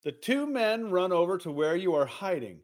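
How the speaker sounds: ordinary talk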